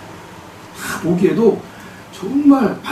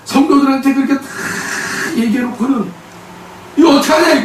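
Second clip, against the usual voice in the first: angry outburst; 9 semitones higher than usual. Retold or imitated. Wife fuming alone over her husband breaking his vow, exasperated. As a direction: let the frustration climb until it bursts.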